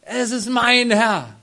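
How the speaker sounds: "Es ist mein Herr" cried out loud and high, slowly, stress on "mein"; proud exclamation. Retold or imitated. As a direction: proclaim "mein Herr" with swelling pride. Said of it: "voller Stolz".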